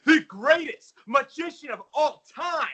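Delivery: loud high ring announcer; grand entrance announcement. Pretend. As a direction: announce yourself to the arena. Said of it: "let me reintroduce myself".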